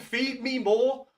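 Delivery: Ryback catchphrase. Retold or imitated. Streamer begging chat for views like a wrestler, slow mock chant. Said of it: "Like Ryback".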